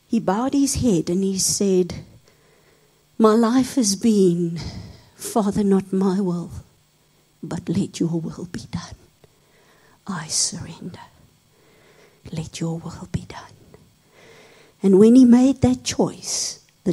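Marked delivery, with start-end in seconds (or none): 3.2-13.5 soft, low, fading whispered prayer; whispered surrender. Acted. Man bows his head and whispers his surrender. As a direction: surrender quietly, almost breathless.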